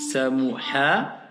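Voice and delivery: slow Sa, mou, ha, each apart; syllable reading. Shown as a demonstration. Teacher sounding out the word for the student. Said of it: "La lettre qu'on a fait aujourd'hui".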